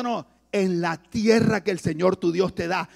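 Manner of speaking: ordinary talk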